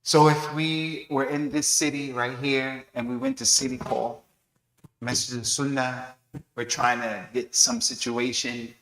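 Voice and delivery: ordinary talk, nothing marked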